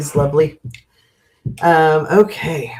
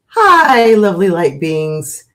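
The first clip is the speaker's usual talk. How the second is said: greeting; 3 semitones higher, 5 dB louder, slower than usual. Real, not acted. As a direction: sing out a big hello. Greeting viewers by name.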